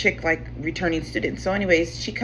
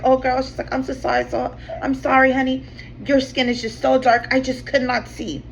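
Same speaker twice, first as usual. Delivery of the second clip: higher, sugary apology, lightly imitated; lightly voiced apologetic partner; 7 semitones higher than usual. Lab partner apologizes to the narrator in a higher mock-sweet voice. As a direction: mimic her sweet sorry tone, pitched up.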